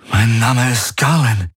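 a hint of dazed recognition; mild wonder. A narrator's reading. Garlin realizing who he is, mild wonder. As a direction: realize it as you say it. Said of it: "sagte er verblüfft".

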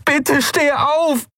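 very high, slow, begging cry; desperate pleading. A narrator's reading. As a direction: drag out the plea in despair. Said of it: "verzweifelt rufen".

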